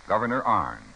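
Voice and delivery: ordinary talk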